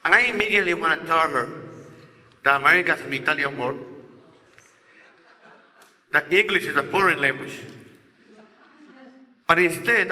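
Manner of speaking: usual speaking voice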